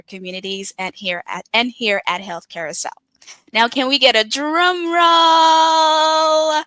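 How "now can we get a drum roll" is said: slow, drawn-out shout for a drum roll; host hyping the crowd, loud and slow; hyped call-out. Real, not acted. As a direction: rev the crowd up before the big reveal. Host hypes the audience.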